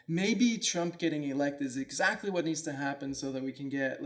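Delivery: ordinary delivery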